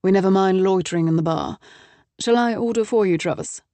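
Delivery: put-on a light, cheerful British accent; brisk British accent. A narrator's reading. Associate offering to order for Travis, light British accent. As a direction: play the breezy English associate, British accent lightly done. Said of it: "in a brisk British accent".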